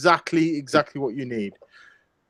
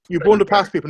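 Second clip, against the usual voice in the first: ordinary talk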